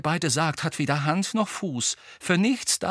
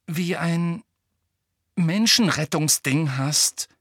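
halting, higher, careful phrasing; hesitant; 5 semitones higher than usual. A narrator's reading. Hermine cautiously criticises Harry, haltingly. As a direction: feel your way with long pauses.

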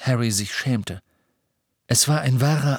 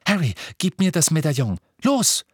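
urgent, higher-pitched command; impatient; 7 semitones higher than usual. A narrator's reading. Hermine orders Harry to hand over the locket, impatient. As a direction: demand it, ending on a very high push. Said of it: "sagte sie ungeduldig".

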